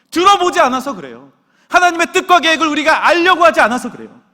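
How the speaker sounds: loud, high exhortation; impassioned pulpit shout; full-force preaching. Real, not acted. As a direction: drive each phrase home to the congregation.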